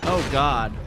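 loud alarmed cry; real panic under fire; panicked gasp. Real, not acted. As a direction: blurt it out in sudden fear.

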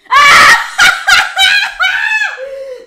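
loud high shriek of laughter. Real, not acted. Streamer shrieking with laughter at the guest's poem, loud high laugh. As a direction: burst into a screaming laugh. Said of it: "Me has, me has asustado".

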